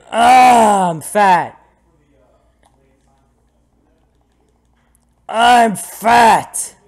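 loud, high mock-anguished shout; hammed-up yell. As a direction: bellow it in mock despair. Yelling about game character's weight.

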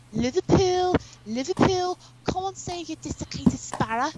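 high-pitched Liverpudlian coaxing, lightly put on; acted Liverpool stage line. Student actor coaxing a parrot, high Liverpudlian accent. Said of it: "Her, her line was".

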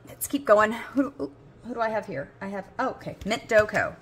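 ordinary delivery

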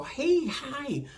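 put-on high-pitched playful hello to a little kid; chirpy toddler greeting. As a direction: pop up and coo to distract a fussy toddler.

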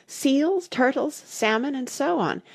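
high-pitched, excited listing; crying out. A narrator's reading. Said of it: "cried the Mock Turtle".

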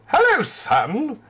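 bright high-pitched greeting; chirpy young character voice; high cheerful voice. Acted. Richard greets the sun.

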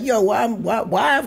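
high incredulous why. Retold or imitated. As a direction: plead it, pitch climbing. His own outraged complaint.

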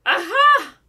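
loud, high triumphant aha; mocking cry. A narrator's reading. Enchantress springs her trap on the prince, loud mocking cry. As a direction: crow in triumph. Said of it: "she cried mockingly".

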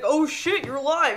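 high, loud startled gasp of a line; mock shocked. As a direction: imitate his voice jumping up in shock. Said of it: "you heard that voice fucking change".

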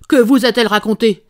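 shouted in fury at Holmes' dodging; furious shout. Acted. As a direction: explode with the question this time. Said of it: "s'écria le vieux, furieux".

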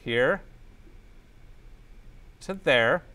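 ordinary talk

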